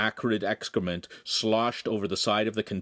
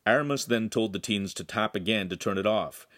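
usual speaking voice

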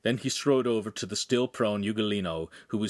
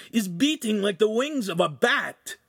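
frantic pleading; 11 semitones higher, 4 dB louder than usual. Acted. Ugolino pleads he is alive.

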